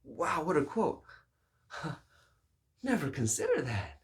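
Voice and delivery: mock amazement. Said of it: "They say".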